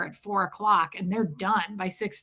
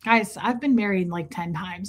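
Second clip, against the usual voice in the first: ordinary delivery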